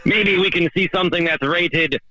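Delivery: put-on high, hammy pirate accent, arr matey style; a pirate captain voice hamming it up on a voicemail. Caller woos Shelly on voicemail in his pirate accent, hammed up high. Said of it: "I know you've never heard this voice before".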